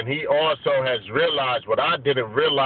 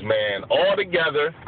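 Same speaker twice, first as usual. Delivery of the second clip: usual speaking voice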